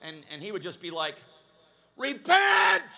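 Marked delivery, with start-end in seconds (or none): 2.0-2.8 explosive high shout; one huge pulpit yell; revival preacher. Acted. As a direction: bellow it like an old-time revivalist. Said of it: "the revival preacher would get up".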